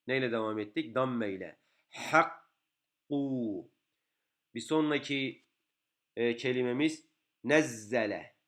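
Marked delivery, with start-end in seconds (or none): none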